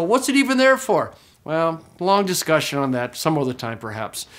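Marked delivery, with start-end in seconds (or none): 0.0-1.1 mock puzzled student. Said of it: "So you go".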